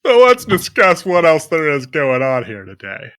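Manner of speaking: booming ship captain announcing the agenda. Pretend. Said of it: "You've gotta do a voice".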